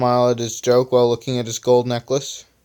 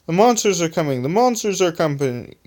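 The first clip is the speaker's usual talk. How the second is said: half-hearted high-pitched mock yell; enthusiastic yell; 6 semitones higher than usual. A narrator's reading. Earth Eater warns the team, mildly enthusiastic. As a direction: sing out the warning without real volume.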